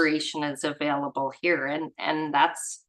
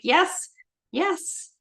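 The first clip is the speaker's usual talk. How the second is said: normal talking voice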